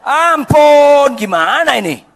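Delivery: high loud cry of dismay; shocked cry. Acted. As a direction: wail it out, appalled. Mother shocked at bride. (teriakan kaget, tinggi dan keras)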